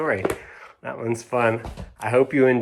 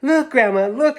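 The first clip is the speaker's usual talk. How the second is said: high, louder excited call; shouting; 13 semitones higher, 6 dB louder than usual. Acted. Children shouting to Grandma to look, high and loud. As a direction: kids calling Grandma to see. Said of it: "shouted the children".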